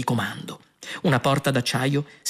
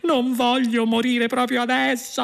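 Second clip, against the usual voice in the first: high-pitched desperate cry; shouted protest; 10 semitones higher than usual. A narrator's reading. Doomed prisoner pleading not to die, shouting high. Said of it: "urlò".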